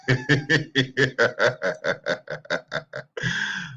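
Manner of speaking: drawn-out helpless laughter; long laugh. Real, not acted. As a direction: laugh it out for a while. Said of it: "I can't stop laughing".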